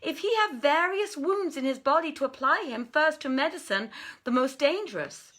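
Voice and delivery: a wazir earnestly urging the Sultan; wise wazir counselling the king. Acted. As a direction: advise your king gravely but firmly.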